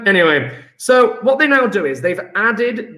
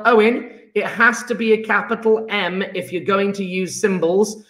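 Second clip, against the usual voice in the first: ordinary delivery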